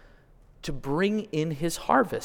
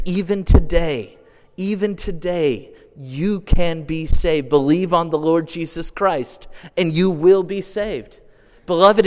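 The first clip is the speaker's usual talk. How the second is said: raised, impassioned gospel appeal; louder preaching with long stretched words; fervent preaching; 3 semitones higher, 5 dB louder than usual. Acted. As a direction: press the call home to the crowd. Preaching gospel call at full force.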